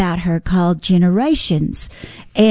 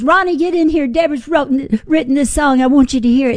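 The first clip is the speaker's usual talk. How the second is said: higher, eager call across the house; excited calling out; 7 semitones higher than usual. Retold or imitated. Brenda calling her husband to hear the song, excitedly higher. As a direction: call him in, thrilled. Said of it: "She goes".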